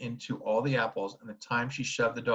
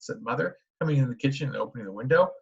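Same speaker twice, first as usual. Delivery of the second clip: usual speaking voice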